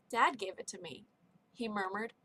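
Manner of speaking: ordinary delivery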